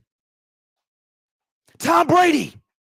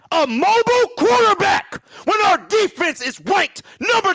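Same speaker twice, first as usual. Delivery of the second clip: exasperated fan yelling at the audience; angry shouting; 7 semitones higher than usual. Real, not acted. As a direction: blow up at the callers, yelling. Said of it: "I said I was done with the emotion".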